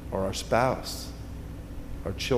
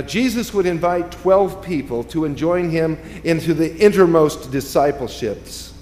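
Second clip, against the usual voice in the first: ordinary talk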